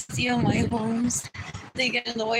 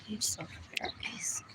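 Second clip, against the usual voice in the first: hushed whisper; 3 semitones lower, 11 dB quieter than usual. Acted. Whispering an embarrassed aside to viewers. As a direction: confess it in a whisper.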